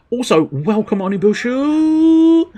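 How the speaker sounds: high, loud drawn-out welcome; excited greeting. Real, not acted. Streamer welcoming a viewer, high and loud. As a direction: call the name out high and long.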